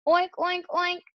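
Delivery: high-pitched playful oinks; squeaky pretend piggy; pig oinking. A narrator's reading. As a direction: oink like a child playing pig. Boy imitates pig.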